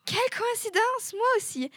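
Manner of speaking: high-pitched, hammed-up surprise; theatrical astonishment; amazed delight. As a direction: exclaim at an amazing coincidence, voice lifted. Acted delight at a coincidence.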